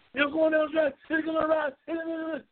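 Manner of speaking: quoting his own cocky call before the race; hyped prediction. Retold or imitated. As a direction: sell it like it's a sure win. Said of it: "I said".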